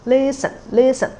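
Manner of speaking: "Listen, listen" with a high first syllable, then dropping; high then low tone demo. Shown as a demonstration. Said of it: "use listen again".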